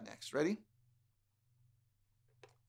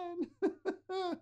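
princess voice; 10 semitones higher than usual. Acted. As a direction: sing it out like a fairy-tale princess. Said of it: "let's all be princesses".

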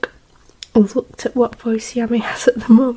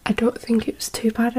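soft hushed whisper; 6 dB quieter than usual. Real, not acted. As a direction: whisper it so nobody hears. Avoiding noise while recording.

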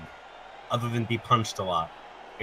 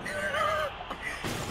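high shrieking laugh; burst of laughter; 7 dB quieter than usual. Real, not acted.

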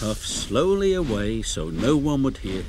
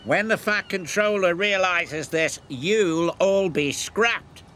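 higher-pitched taunting sneer; 6 semitones higher than usual. A narrator's reading. Diesel threatens Gordon with the scrapyard, sneering. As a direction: drag out the threat at the end. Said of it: "he sneered".

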